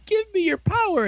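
high, hammed-up plea for power; mock power demand. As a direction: act out a cartoonish demand.

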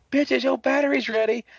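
high-pitched, sing-out announcement; mock triumphant call. Torin arrives with charged batteries, high mock call. Retold ally calls out on arrival.

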